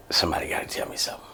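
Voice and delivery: hurried whispered thought, fast. Retold or imitated. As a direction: whisper it to yourself, rushing. Cuffed, thinking to himself.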